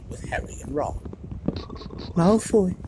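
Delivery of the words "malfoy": a touch shaky, higher; shakily. A narrator's reading. Neville shaken after the curse, naming Malfoy. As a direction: say the name still shaken. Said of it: "said Neville shakily".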